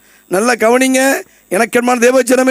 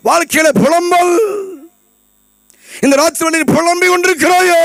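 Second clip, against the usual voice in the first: raised, tearful preaching cry; impassioned preaching; 6 semitones higher than usual. Acted. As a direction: cry out to the congregation with feeling. Preacher crying out to congregation.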